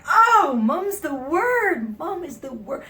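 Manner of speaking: very high, loud, drawn-out outburst; exaggerated exclamation. Customer reacting to the hostess, exaggerated loud exclamation. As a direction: cry it out with theatrical gusto. Acted exclamation in retold seating scene.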